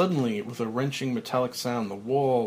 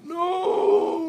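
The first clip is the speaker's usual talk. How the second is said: very high, loud hysterical cry; hysterical scream; 6 dB louder than usual. Acted. Buried man screaming hysterically as dirt falls. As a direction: scream it in pure terror. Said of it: "Frank hysterically".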